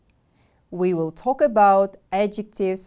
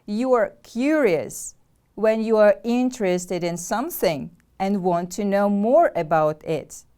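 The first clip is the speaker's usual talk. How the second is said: ordinary talk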